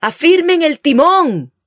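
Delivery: high, loud captain's command; shouted order. A narrator's reading. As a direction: bark the order at the helm. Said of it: "gritó el capitán".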